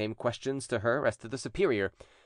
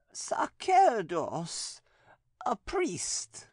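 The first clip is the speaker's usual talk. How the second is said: much higher, slow, eerie possessed voice; possessed nun; 12 semitones higher, much slower than usual. A narrator's reading. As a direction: speak as the demon inside her, slowly. Possessed nun answers exorcist.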